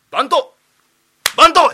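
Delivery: high, loud angry summons; furious call. Acted. Master shouts for the head clerk, furious loud call. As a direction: bellow for the clerk.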